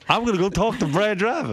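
mock a coworker's voice; Baba Booey. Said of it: "He's gonna go".

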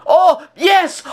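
real high, loud cry of triumph; joyful yell. Real, not acted. Player scores late and yells high with joy. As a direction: let it burst out as the ball goes in.

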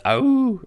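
high wolf howl; drawn-up "ow" like a wolf; imitated wolf howl. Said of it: "He actually does".

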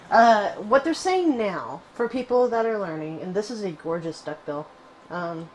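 ordinary talk

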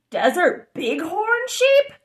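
usual speaking voice

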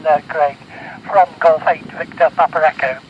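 usual speaking voice

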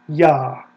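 Yah, firm, with an open ah; syllable Yah. Shown as a demonstration. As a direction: stamp Yah down as the answer. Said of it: "of the divine name".

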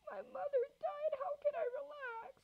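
hammed-up distraught child; tearful protest. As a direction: wail back at the dispatcher.

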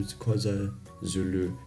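ordinary talk, nothing marked

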